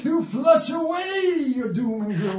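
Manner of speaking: high, shouting revival preacher; mock preacher working up the crowd; preaching.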